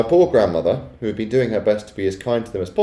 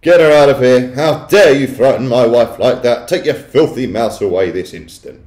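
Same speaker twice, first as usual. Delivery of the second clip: loud, towering outrage; loud, blustering, indignant tirade; furious shouting; 4 semitones higher, 9 dB louder than usual. A narrator's reading. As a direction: bellow orders at someone to get out.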